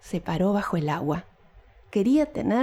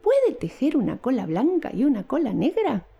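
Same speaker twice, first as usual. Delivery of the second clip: kitten voice; 9 semitones higher than usual. A narrator's reading. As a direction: sweetly ask like a tiny kitten. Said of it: "kittens ask the weaver".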